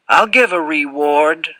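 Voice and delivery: half copy a Southern drawl; almost imitating Southern accent. A narrator's reading. Boy offering the fat man money, almost imitating his Southern accent. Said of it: "almost imitating his accent".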